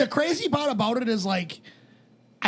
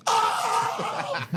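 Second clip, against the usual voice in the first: a high, surprised cry of recognition; excited oh; 12 semitones higher than usual. Retold or imitated. Retelling a friend hearing Russo's name, high surprised oh. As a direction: jump way up in pitch with delight.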